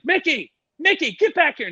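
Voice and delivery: high, urgent retold call. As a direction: imitate calling a runaway pet back. Owner calling his runaway baboon.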